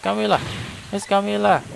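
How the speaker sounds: calling out. Acted. Calling out to trapped Camilla.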